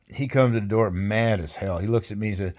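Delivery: usual speaking voice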